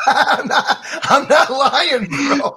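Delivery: high, loud insistence through laughter; drawn-out, giggly denial; laughing protest. Real, not acted. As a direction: insist while cracking up. Protests while laughing about crying.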